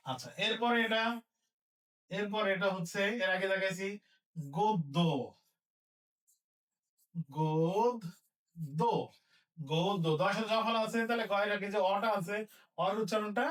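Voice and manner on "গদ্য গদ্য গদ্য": গদ্য said low and split as god-do; closed o demo. Shown as a demonstration. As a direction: say গদ্য slowly with a round o, god... do. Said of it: "ও এর মতো হবে গদ্য".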